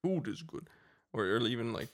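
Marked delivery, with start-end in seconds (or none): 0.0-0.7 higher echo of the game line; game narrator voice. Acted. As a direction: repeat the game announcer line. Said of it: "the guy from Gauntlet Legends".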